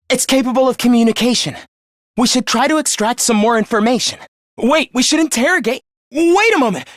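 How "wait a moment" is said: urgent interjection. Acted.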